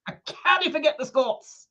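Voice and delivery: lightly hammed Scots brogue; Scottish accent. Co-host repeats the line in a practised mock Scottish accent. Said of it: "See, been practicing that".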